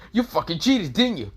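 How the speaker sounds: high, loud voice of a cheated player; outraged accusation. Acted. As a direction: accuse them in mock outrage. Acted accusation over card game.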